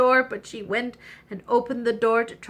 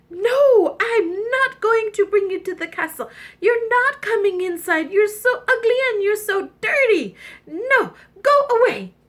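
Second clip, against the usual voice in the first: much higher and loud, slamming the door shut; shrill angry refusal; 11 semitones higher, 6 dB louder than usual. Acted. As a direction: scold him away in a high, cross shout. Princess refuses the frog.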